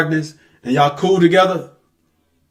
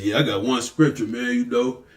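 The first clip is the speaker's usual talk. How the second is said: much lower, softer smug imitation; mock know-it-all; 7 semitones lower, 8 dB quieter than usual. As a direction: mumble it like a lazy boast. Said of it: "You say".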